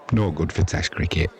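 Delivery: normal talking voice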